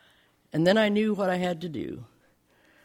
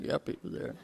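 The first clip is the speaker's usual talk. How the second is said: whispered aside; 5 semitones lower, 9 dB quieter than usual. Retold or imitated. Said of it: "Dick's over there going".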